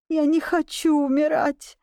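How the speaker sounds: high, trembling-lipped begging; whimpering plea. A narrator's reading. Tutor begging the duke for his life, whimpering. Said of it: "шептал он трясущимися губами".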